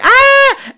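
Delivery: short, high, loud mock shriek; mock scream. Maggie grabbed by the claw hand, mock screaming. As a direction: let out a quick high yelp. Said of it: "And she's like".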